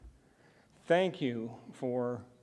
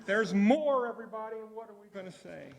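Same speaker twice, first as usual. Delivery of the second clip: excited; 9 semitones higher than usual. Real, not acted. Leading the crowd, building up.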